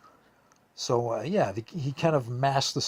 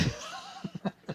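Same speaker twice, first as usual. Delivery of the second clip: high giggle; 7 dB quieter than usual. Real, not acted. Laughing at the prank.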